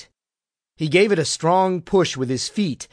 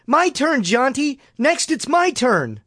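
shouting; 10 semitones higher than usual. A narrator's reading. Bud watching his brother swing higher, shouting for a turn. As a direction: shout it up high to someone on a swing. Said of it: "and shouted".

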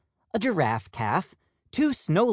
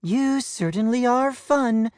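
mother peacock; 8 semitones higher than usual. Acted. Judy the mother peacock speaks.

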